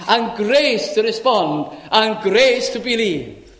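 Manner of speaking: loud, high, slow proclamation; full-force preaching. Acted. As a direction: thunder the Lord's words from the pulpit. Preacher voicing the Lord.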